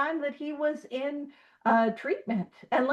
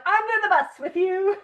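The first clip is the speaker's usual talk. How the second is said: playful quote; 7 semitones higher than usual. Retold or imitated. Acted jokey remark to colleague.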